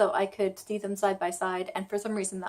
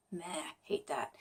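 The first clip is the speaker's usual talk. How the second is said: grumbled aside; 3 semitones lower, 11 dB quieter than usual. Real, not acted. Annoyed aside about tone changes.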